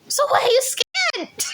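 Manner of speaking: very high squealed question; squeaky imitated question, tipping into laughter; laughing question. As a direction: ask it way up high, near laughter. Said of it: "this is why I'm laughing".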